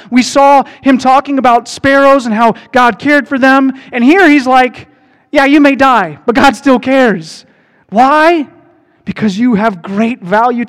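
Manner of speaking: loud, high, driving sermon voice; fired-up preacher pounding home the point; preaching at full force. Acted. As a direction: proclaim it like you want the back row to hear. Preacher rises again.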